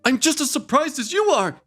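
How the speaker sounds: high, hammed-up innocent protest; mock innocent fiance. Retelling her fiance's denial in a mock innocent voice. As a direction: play the wide-eyed guilty boyfriend. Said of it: "he claims criminally".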